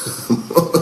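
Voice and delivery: quiet, breath-led laugh; real subdued chuckling; soft chuckle. Real, not acted. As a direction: laugh softly to oneself. Amused disbelief after the rant.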